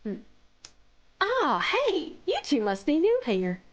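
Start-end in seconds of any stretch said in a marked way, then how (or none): 1.2-3.6 Southern-voiced bear, high opening greeting; Southern accent. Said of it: "Mina is Southern".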